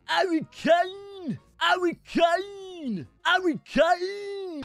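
high, long-held player-name cheer; drawn-out name holler. Real, not acted. Gamer hailing a player on stream, drawn-out holler. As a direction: stretch the name out, soaring high.

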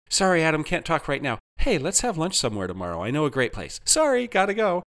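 high, bustling excuse; breezy, high-pitched hasty goodbye; hurried brush-off. A narrator's reading. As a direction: rattle it off while rushing away. Friend hurriedly brushing him off.

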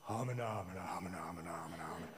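unvoiced huddled muttering; whispered murmur. A narrator's reading. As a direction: whisper it like conspiring advisors.

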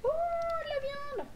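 high, slow excited cry. Acted. Player delighted at finding meat, excited. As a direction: squeal with delight over the find.

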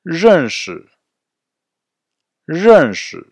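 "Zheng Shi" high, loud, falling tone; model for repetition. Shown as a demonstration. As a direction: hit "Zheng Shi" loud with a sharp fall. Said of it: "répétez bien après moi". (« Zheng Shi » fort, ton descendant marqué)

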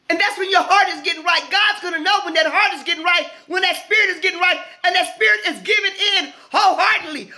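high, driving sermon delivery; impassioned pulpit exhortation, pitched well up; full-force preaching. Real, not acted. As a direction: preach it hard and high, pressing every phrase.

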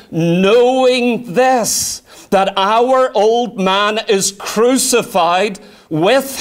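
very slow, weighty scripture reading; drawn-out solemn quote; slow emphatic reading. Shown as a demonstration. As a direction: read it slowly, letting each word land. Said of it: "he uses this word "to know".

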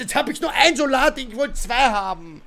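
usual speaking voice

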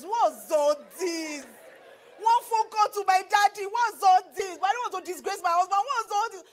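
a wife fed up, shouting to herself; exasperated shout. Retold or imitated. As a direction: cry out in frustrated disbelief. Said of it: "I was saying to myself".

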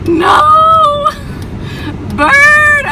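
high, loud crying shout; panicked shriek. Real, not acted. Driver screaming as bird clings to car. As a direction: scream no in real terror. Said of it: "I'm scared".

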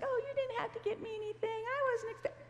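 very high, fast, flustered thanks; flustered delight. Retold or imitated. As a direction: gush quickly, way up high, caught off guard. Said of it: "she's totally different spirit about her".

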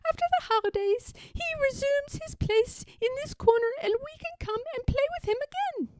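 very high, squeaky mouse voice; piping storybook mice; delighted little mice. A narrator's reading. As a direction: explain happily as a tiny mouse. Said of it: "the delighted little mouse say".

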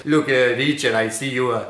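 put-on a boss with a heavy Israeli accent. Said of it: "he has a heavy Israeli accent".

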